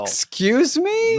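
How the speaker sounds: high, slow drawn-out excuse me; mock shock. Cohost reacting to a crude carnival joke, mock shocked. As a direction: play it scandalised and stretched.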